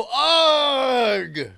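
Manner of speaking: long, drawn-out shouted "Ugh"; acted shout. Player calling out in the game, shouting. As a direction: holler it out and hold it. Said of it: "shout "Ugh".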